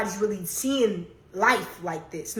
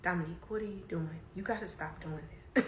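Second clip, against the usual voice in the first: calm quiet quote; 3 semitones lower, 12 dB quieter, faster than usual. Retold or imitated. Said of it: "It's like".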